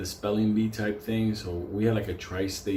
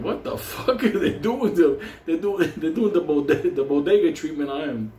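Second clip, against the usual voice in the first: high, loud mock disbelief; shocked; 6 semitones higher, 5 dB louder than usual. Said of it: "I was like".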